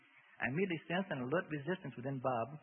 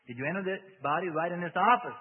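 loud, higher demand; insistent questioning; 5 semitones higher, 11 dB louder than usual. A narrator's reading. Bob interrogates the demon, loud and insistent. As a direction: press the question hard.

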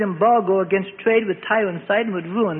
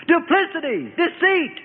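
much higher, each word flung out; screamed accusation; 9 semitones higher, slower than usual. A narrator's reading. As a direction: hurl each charge at the jailers. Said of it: "Herod screamed".

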